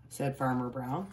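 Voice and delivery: ordinary delivery, nothing marked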